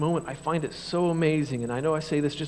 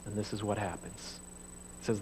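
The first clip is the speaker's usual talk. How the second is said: normal talking voice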